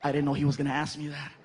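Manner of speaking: ordinary talk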